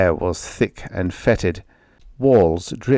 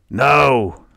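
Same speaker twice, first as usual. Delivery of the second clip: sharp no; 5 semitones higher, 5 dB louder than usual. A narrator's reading. Harsh voice cuts uproar.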